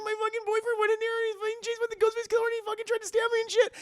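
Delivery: high, loud, rapid gabble; hammed-up hysterical witness; mock panic. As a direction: blurt it all out frantically. Retold panicked report to deputy.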